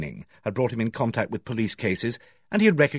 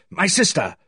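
higher-pitched shocked protest; violent outrage; 6 semitones higher than usual. A narrator's reading. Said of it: "said Owen violently".

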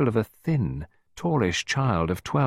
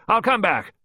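high, loud, hearty boom; 12 semitones higher, 4 dB louder than usual. A narrator's reading. Visitor interrupting the man, booming. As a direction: boom it out, cutting in. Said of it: "she boomed".

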